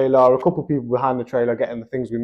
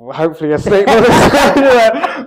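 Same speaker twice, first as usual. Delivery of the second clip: laughing through the joke, higher and louder at the end; 7 semitones higher, 6 dB louder, slower than usual. Real, not acted. Telling a friend about the farm, laughing. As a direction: bursts into a laugh at his own joke.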